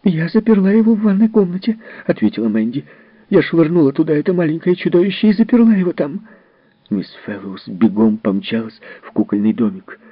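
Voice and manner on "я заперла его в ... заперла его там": high, agitated nurse voice; nurse Mandy's defence. Acted. Nurse Mandy explains to Miss Fellowes, high and agitated. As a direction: shrilly justify locking the child up. Nurse admits locking Timmy away.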